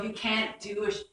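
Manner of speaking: ordinary delivery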